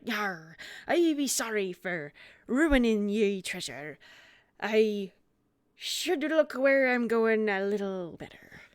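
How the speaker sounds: a touch of sheepish pirate. Acted. Pirate apologizes to the monkey, lightly voiced sheepish pirate. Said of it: "and he's like".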